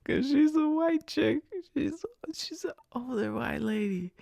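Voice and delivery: high, strained voice after laughing; cracking up. Real, not acted. Storyteller explaining the couple, high voice from laughing. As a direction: squeeze it out through leftover laughter.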